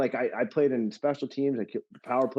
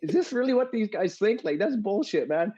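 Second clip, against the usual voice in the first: high, outraged retold rant; fired up; 10 semitones higher than usual. Walking out of arbitration, fired up and outraged. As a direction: let it rip like you just heard an insult. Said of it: "I'm like".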